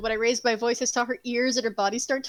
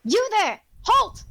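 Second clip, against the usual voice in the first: raised, higher barked command; guard calling halt; 7 semitones higher, 4 dB louder, slower than usual. Acted. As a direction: stop the stranger like a guard on duty. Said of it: "hears one of the guards go".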